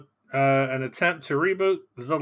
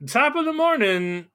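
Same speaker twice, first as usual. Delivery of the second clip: stage Irish brogue, pitched much higher; mock Irish greeting; 12 semitones higher than usual. Co-host answers the host's greeting in a hammed-up Irish voice. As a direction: sing out the greeting like a cartoon Irishman.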